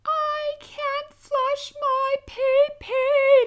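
very high, loud, drawn-out wail; screaming toddler. Retold or imitated. Mom imitating her son's slow, very high, loud tantrum wail. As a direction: wail it like a toddler losing his mind. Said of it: "running around the house screaming".

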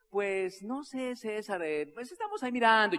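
drawn-out, wavering evasive reply; noncommittal. Retold or imitated. Genaro dodges César's question, noncommittal.

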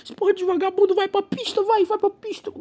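high, posh-mocking whine; mock a stuck-up rich man shooing skaters; snooty rich guy. Imitating the rich man scolding the skater. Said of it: "ele fica de rico".

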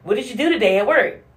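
a hint of a woman asking her silent man. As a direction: ask brightly and quickly like a chatty partner. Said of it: "a woman asks you".

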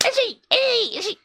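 high, peppy shouts of a demo seller; pumped salesman. Pretend. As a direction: psych up like a market salesman. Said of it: "営業マンいける。".

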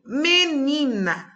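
menina stretched out very slowly, syllable by syllable; slow syllable demo. Shown as a demonstration. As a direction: sound out menina slowly for the children. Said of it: "por exemplo". (pronunciando "menina" bem devagar, sílaba por sílaba)

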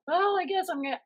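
high, whiny self-mocking voice; high mock whine. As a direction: squeak it out in self-pity. Mock self-quote, high pitched.

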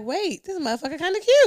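mock surprise. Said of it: "you be like".

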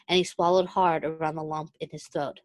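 usual speaking voice

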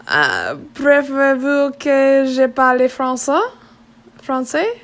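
French. Acted. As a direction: ask it slowly in French. Chief switches to French.

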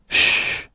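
unvoiced "shh"; hushing. A narrator's reading. Goldilocks hushes the others by the sleeper, shushing. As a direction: shush them to keep quiet. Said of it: "said Goldilocks".

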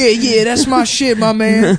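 high, slow hyped-up dude; hype fan voice. Retold or imitated. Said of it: "They're like".

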